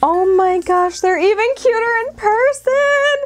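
gushing, rising in pitch; delighted. Real, not acted. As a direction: build into a high excited gush. Delight at seeing mugs unboxed.

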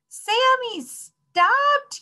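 put-on very high, loud children's shout; yelling. A narrator's reading. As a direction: shriek at him to stop. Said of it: "yelled the children".

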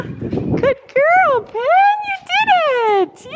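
very high, loud, slow you did it; squealing praise; excited praise. Real, not acted. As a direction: celebrate her success. Penny finally does it.

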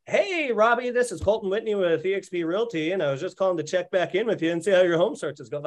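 high, bright-pitched fake-friendly pitch; hammed-up realtor phone voice; cheesy sales voice. Shown as a demonstration. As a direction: play the cheesy follow-up caller. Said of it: "that shit doesn't work".

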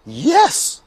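high, glad cry; witch's cry. A narrator's reading. Witch welcomes the dog aboard, crying out high. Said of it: "cried the witch".

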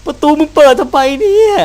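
high, loud, panicky cry; real frightened yell; scared shout. Real, not acted. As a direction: yell at the door in alarm. Door opens by itself.

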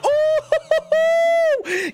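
very high, loud held squeal; excited whoop. Real, not acted. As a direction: whoop long and high in delight. Guaranteed epic appears.

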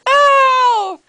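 just got slapped. Acted. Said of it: "did you just slap me".

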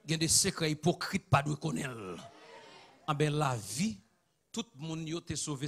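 ordinary talk, nothing marked

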